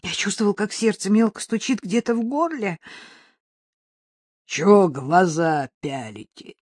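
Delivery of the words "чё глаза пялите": low, loud, slow menace; frightening monster voice. A narrator's reading. Mugger confronting a couple in the dark, menacing. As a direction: challenge them slowly like a monstrous thug. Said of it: "самый страшный голос на свете".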